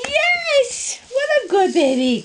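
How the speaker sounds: excited praise. Real, not acted. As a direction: gush over the puppy. Praising puppy.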